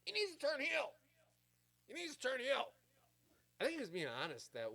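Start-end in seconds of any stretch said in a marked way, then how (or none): 0.0-0.9 high, loud, nagging fan voice; mock the internet crowd demanding a change; a nasal chorus of know-it-all fans; whiny online fans. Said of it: "You got the internet wrestling community all just". 1.9-2.7 high, louder nagging repeat; mock fan chant, slightly softened; whiny online fans. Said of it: "You got the internet wrestling community all just".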